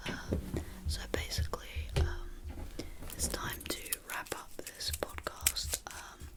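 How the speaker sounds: barely audible whispered sign-off; ASMR whisper. Pretend. Podcaster closing the show as mock ASMR, whispering. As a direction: whisper it as softly as you can.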